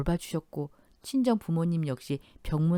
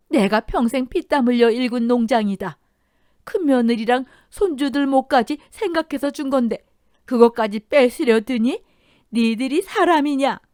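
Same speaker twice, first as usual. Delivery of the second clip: high, loud furious shouting; 7 semitones higher, 8 dB louder than usual. Acted. Mother-in-law shouting at her greedy children, furious. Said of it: "소리를 지르셨어요".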